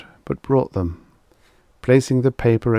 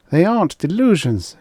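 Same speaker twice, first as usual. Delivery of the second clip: higher, firm protest; a woman insisting she is sane; 9 semitones higher, 4 dB louder than usual. A narrator's reading. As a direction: refuse to be dismissed. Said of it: "she insisted".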